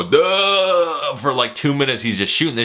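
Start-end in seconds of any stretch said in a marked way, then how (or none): 0.0-1.1 long, higher hammed-up holler; mock battle yell. Reviewer imitating a hero gunning everyone down, mock yell. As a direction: hold one drawn-out yell as if firing.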